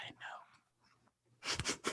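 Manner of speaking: soft, breathy whisper of agreement; a knowing whisper to a teary friend; hushed reply. Retold or imitated. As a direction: whisper it like sharing a secret. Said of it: "I was like".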